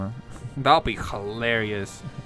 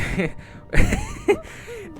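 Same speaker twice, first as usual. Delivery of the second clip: shrieking giggle; high squealing laugh; 7 dB louder than usual. Real, not acted. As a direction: squeal with delight. Laughs at the suit.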